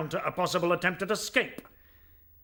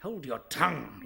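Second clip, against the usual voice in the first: angry command; 3 semitones higher than usual. Acted. General Woundwort silencing the prisoner at his court-martial, furious. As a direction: cut him off with one furious word.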